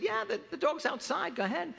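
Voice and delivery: mild character voice for the dog seller. Acted.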